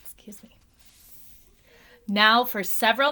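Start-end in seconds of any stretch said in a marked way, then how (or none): 0.0-0.6 soft unvoiced whisper. Real, not acted. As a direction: whisper aside to the listeners.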